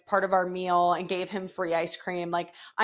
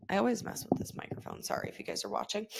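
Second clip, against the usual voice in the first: quiet aside; 9 dB quieter, faster than usual. Real, not acted. Aside about the microphone.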